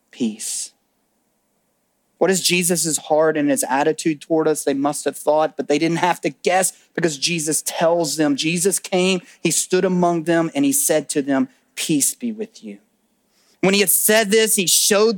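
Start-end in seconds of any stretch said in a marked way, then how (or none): 0.1-0.7 much softer and lower, one gentle word; tender hush. Acted. Jesus greeting frightened disciples, soft and tender. 11.8-12.8 softer and lower, gentle and unhurried; tender greeting. Acted. Jesus standing among fearful disciples, soft tender greeting.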